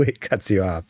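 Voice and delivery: ordinary talk, nothing marked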